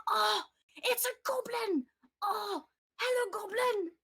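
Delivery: put-on high, eager kid's shout; a small boy thrilled to see a goblin; excited child. Young boy greeting a goblin, high and excited.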